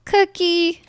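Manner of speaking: high, drawn-out call for someone lost. Real, not acted. Player searching for lost Cookie, calling out high.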